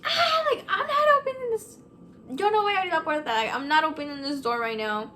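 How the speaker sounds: loud, high shriek that eases into frantic refusal; reenacted screaming. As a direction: act out screaming from the shower, starting very high. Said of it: "screaming in the shower".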